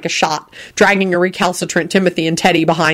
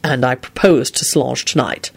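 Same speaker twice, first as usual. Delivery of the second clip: curt reply; 5 semitones lower than usual. A narrator's reading. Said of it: "Quentin replied shortly".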